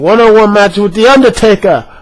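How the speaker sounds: hyped-up match-maker impression; Theodore Long. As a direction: boom out the big match like a showman. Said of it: "no one saying".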